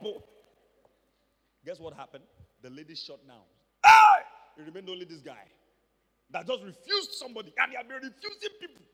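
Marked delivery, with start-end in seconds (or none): 3.8-4.2 high, loud cry of "aye"; loud dismay shout. Real, not acted. Preacher reacting to the window closing, loud dismayed shout. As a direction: shout "aye" in dismay, much higher and louder.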